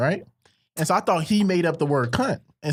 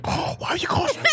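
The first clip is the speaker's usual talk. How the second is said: high, whispery mock outrage; mocking religious friend. Imitating offended religious friend, high breathy squeak. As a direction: squeak it like a scandalized churchgoer. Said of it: "She's like".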